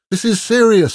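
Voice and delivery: high, loud protest; insist, at the end of your patience; urgent, frustrated demand; exasperated insistence. A narrator's reading. Exasperated demand to be taken seriously.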